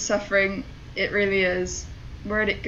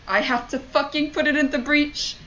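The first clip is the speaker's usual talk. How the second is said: exasperated disbelief; 4 semitones higher than usual. Real, not acted. Player realising what the game demands, exasperated disbelief. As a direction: pitch raised, incredulous protest.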